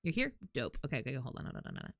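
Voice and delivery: ordinary talk, nothing marked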